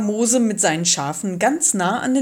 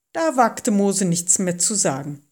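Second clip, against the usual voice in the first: ordinary delivery, nothing marked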